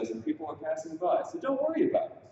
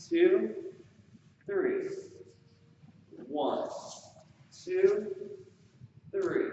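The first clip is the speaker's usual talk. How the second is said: dance count call; 2 semitones higher, slower than usual. Shown as a demonstration. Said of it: "now we'll be doing a slow box".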